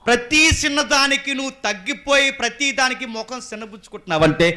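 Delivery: high, loud scolding from the pulpit; loud rebuke. Real, not acted. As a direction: thunder the rebuke at the listeners. Preacher rebukes loudly. (ఎత్తైన స్వరంతో గట్టిగా మందలింపు)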